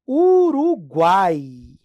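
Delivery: Uruguai drawn out slowly, every vowel clear; slow demo word. Shown as a demonstration. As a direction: stretch out Uruguai so the glide shows. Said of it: "Ó".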